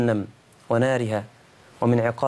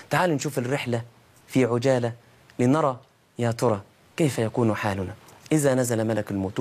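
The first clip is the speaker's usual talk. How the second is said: ordinary delivery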